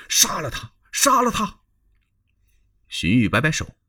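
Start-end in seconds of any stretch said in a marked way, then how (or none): 0.1-1.6 越喊越高越响，最后一声高喊; 怒冲冲地连喊杀了他; shouting. Acted. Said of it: "有人就喊". (众谋士怒喊要杀祢衡)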